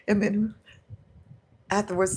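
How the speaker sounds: normal talking voice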